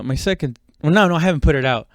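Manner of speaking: usual speaking voice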